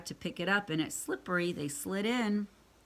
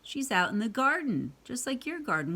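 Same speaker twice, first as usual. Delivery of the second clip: ordinary delivery, nothing marked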